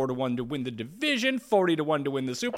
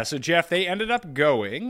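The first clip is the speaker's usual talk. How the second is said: ordinary delivery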